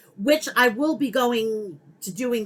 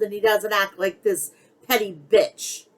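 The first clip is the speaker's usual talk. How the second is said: ordinary talk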